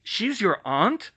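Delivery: slow, high, incredulous question; can't believe it, drawn out; disbelief. Acted. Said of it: "David said in disbelief".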